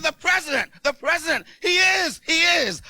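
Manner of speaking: secretary insisting the president is calling; excited secretary. Retold or imitated. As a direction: blurt it out like a thrilled secretary. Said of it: "She said".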